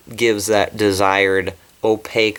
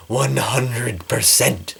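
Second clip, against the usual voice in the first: slow, breathy whisper; whispered echo; much slower than usual. Real, not acted. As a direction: whisper it slowly like a secret. Whispered echo of her endorsement.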